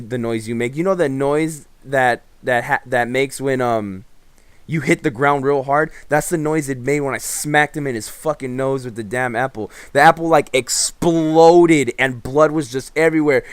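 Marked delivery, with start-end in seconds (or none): none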